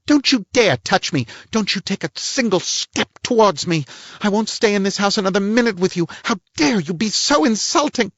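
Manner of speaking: the lady storming at the detective; high, offended woman's voice; outraged lady. Acted.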